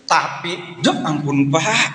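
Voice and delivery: high-pitched mock exasperation; exasperated church member. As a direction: act out a complaint to the pastor. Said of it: "Jangan bilang begini". (jemaat pura-pura mengeluh kesal)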